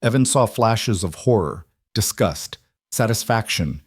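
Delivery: ordinary delivery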